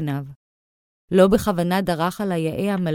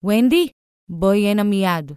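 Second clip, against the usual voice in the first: cross remark rising into a loud call; angry summons; 3 semitones higher, 5 dB louder than usual. A narrator's reading. Father calling his daughter over, angry summons. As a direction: summon her sternly, voice raised. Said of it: "קרא אבא בכעס".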